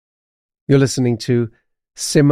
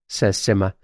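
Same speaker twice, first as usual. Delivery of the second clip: ordinary delivery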